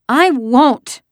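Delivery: put-on high, loud child's refusal; sputtering protest. A narrator's reading. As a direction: sputter it like a furious little girl. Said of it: "Kirsty sputtered".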